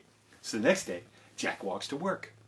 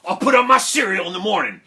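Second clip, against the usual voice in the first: loud, higher, quick burst; eager reply; 7 semitones higher, 7 dB louder, faster than usual. Acted. Jack gladly accepting the farmer's milk, eager. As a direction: blurt it out in eager delight.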